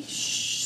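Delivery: gentle unvoiced shh to calm a pet; soft shushing. Real, not acted. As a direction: hush the dog softly. Hushing the dog.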